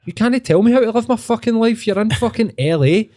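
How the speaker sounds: high-pitched indignant rant at a self-help guru; mock outraged rant. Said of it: "And you're like".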